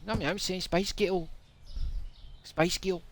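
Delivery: high put-on Scottish voice; mock Scottish accent, earnestly practising. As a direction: keep insisting in high-pitched Scots. Scottish side of accent misunderstanding.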